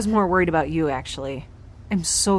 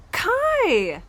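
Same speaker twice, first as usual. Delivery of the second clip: high delighted gasp and call; gasping the name with joy; excited cry; 12 semitones higher than usual. Acted. Greeting the repaired robot, high and delighted.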